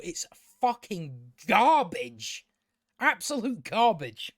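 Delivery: stretched, punched "garbage"; slow, hard stress on "garbage"; exasperated verdict. Real, not acted. Reviewer condemning the show he just watched, exasperated and emphatic.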